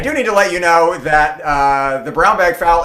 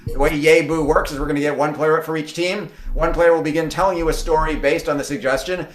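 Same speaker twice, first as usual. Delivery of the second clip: ordinary delivery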